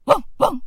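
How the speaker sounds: high yapping barks; dog barks. Acted. (白犬が高く吠える)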